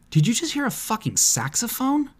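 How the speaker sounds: high, disbelieving squeal; shocked at the saxophone; surprised. Acted. Shocked at the instrument.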